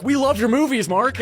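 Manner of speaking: high-pitched fawning fan voice; imitating starstruck parents. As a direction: gush up high at a celebrity. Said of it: "they were equally starstruck".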